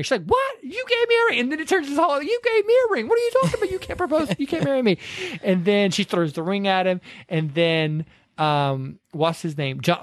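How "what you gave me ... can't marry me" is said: much higher, shrill-pitched indignant outburst, imitating; mock outraged yell. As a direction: act out her hammed-up fury over the ring. Retold outburst at fiancé.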